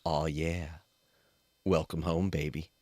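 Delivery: low, soft, sultry purr; mock seductive murmur into the mic; mock ASMR. Giving chat their ASMR for the night, low sultry murmur. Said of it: "Here's your A S M R for the".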